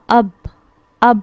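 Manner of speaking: "اب" said short, ba closed with no vowel; sounding out ab. Shown as a demonstration. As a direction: clip "اب" to a bare b sound after the a. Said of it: "اب جب میں اب پڑھ رہی ہوں".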